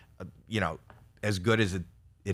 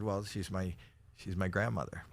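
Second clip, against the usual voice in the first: much softer, a small embarrassed answer; sheepish quiet reply; 2 semitones lower, 9 dB quieter than usual. Retold or imitated. Answering his wife from his grandmother's sink, sheepishly soft. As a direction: mumble it like a caught little boy.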